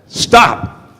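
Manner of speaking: high, sudden acted shout; commanding cry. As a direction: cut the quarrel short as chief frog. Chief frog halts the quarrel.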